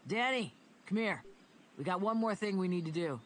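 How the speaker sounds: calling across the house. A narrator's reading. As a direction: call him over, loud. Kevin calling Daniel from inside.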